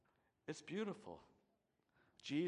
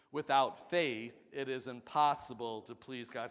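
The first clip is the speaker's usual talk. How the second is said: louder, firm congregational recital; said with conviction; 7 dB louder than usual. Shown as a demonstration. Congregation repeating the verse with conviction, louder. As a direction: say it like you mean it. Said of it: "Say it with conviction".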